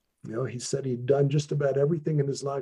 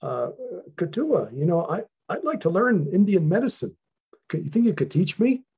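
put-on higher and louder, a naive eager young man asking a favour; eager young guy; 7 semitones higher, 5 dB louder than usual. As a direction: play the starry-eyed newcomer, hopeful and keen. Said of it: "this guy said".

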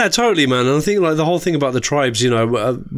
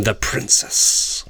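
whispered; 4 semitones lower, slower than usual. Pretend. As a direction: breathe the words out. Breathy whispered sign-off.

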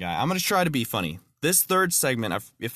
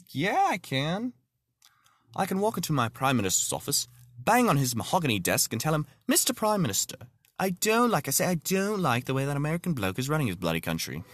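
mock British accent; 3 semitones higher than usual. British guy's quote in joke.

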